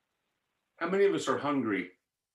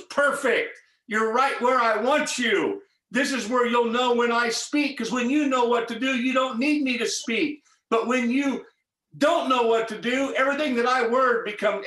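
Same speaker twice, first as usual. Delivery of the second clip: God's emphatic reply; 6 semitones higher, 4 dB louder than usual. Retold or imitated. Voices God's emphatic answer.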